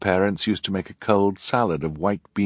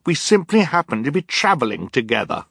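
indignant; 8 semitones higher, 5 dB louder than usual. Acted. Violet continues.

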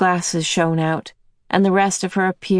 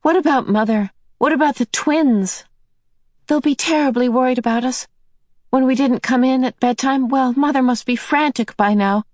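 put-on higher girl's voice, anxious; higher worried teenage girl; Meg worried; 7 semitones higher than usual. A narrator's reading. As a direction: fret aloud as the girl.

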